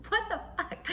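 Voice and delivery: high, laughing what the fuck; stunned disbelief. Real, not acted. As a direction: blurt it in shocked disbelief. Stunned by nurse's reversal.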